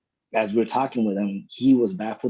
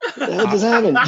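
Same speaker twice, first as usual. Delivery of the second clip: baffled friend; 11 semitones higher, 5 dB louder than usual. Retold or imitated. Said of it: "is still sitting there going".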